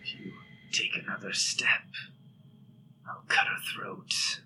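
a monster's whispered threat; creature whisper. Acted. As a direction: threaten in a cold hushed whisper.

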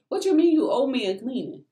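high, incredulous retort; indignant disbelief. Retold or imitated. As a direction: throw the question back in disbelief. Retelling indignant reply to landlady.

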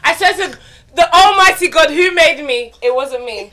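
loud, laughing denial; laughing protest. Real, not acted. To friends who blame her, a loud laughing denial. Denies writing the question, laughing.

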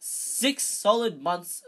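slow, loud, high brag; loud boasting. A narrator's reading. Lockhart bragging to Harry, loud boasting. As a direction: stretch out the brag proudly.

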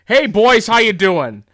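high, loud, hearty greeting; Tom Atkins impression. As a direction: hail the boys with booming enthusiasm. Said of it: "inflection is so spot on for him".

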